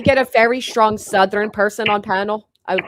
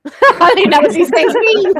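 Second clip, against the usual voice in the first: high, loud, through hard laughter; helpless giggling confession; laughing; 10 semitones higher, 3 dB louder than usual. Real, not acted. Host baffled by British insults, laughing.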